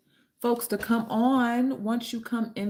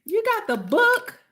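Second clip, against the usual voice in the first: high, loud, drawn-out question; real excitement, pitched way up; thrilled surprise; 6 dB louder than usual. Real, not acted. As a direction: exclaim it slowly in delighted disbelief. Thrilled a viewer has the book.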